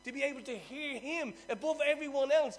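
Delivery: preaching. Acted. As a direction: preach it with full fire. Preaching at full force.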